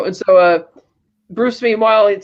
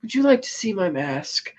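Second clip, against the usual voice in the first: mild menace; 6 dB quieter than usual. Retold or imitated. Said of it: "takes a breath and he's like".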